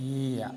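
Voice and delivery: ఈయా, soft long 'ee' into 'ya'; ఈయా with 'ya' in place of r; German pronunciation. Shown as a demonstration. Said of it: "ఈయా అని పలుకుతారు".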